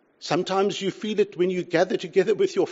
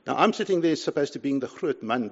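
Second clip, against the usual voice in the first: ordinary talk, nothing marked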